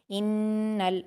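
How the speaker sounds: "इन्नल" with the doubled n held through the nose; held nasal ghunna. Shown as a demonstration. Teacher demonstrating ghunna, nasal hum on "इन्नल". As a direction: press firmly on the n of "इन्नल" and let it hum. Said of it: "और गुन्ना करके पढ़ेंगे".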